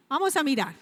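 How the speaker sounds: normal talking voice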